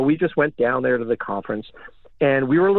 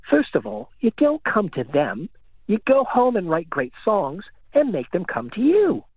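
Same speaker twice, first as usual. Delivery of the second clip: higher-pitched mock-British imitation of a scolding mentor; terrible British accent; 9 semitones higher than usual. Music veteran lectures young band at a conference, terrible British accent. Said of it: "my terrible British accent".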